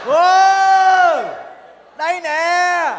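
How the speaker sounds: high shouted reply with a laugh; answering shout. Acted. Đực shouting back to her from the boat.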